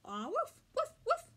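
high, punchy woofs; dog bark. A narrator's reading. Marley the dog answers the family, barking. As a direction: voice a dog's sharp woofs. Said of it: "he barked".

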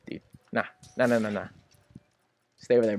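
ordinary talk, nothing marked

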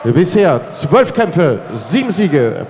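usual speaking voice

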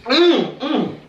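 humming while chewing; closed-mouth chewing mumble; mouth full chewing. Real, not acted. Seen 4: Tank-top speaker's lips pursed, jaw working while humming "mm, mm.

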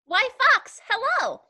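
high, perky mouse greeting. Acted. Mouse greets Fox beside the Gruffalo, high mouse voice. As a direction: pipe a bright hello as the mouse. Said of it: "such an impressive mouth voice".

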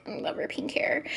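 soft, hushed fondness; whispered aside. Real, not acted. Admiring the cop girl's hair, whispering. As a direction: whisper it like a little secret.

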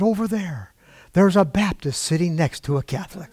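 normal talking voice

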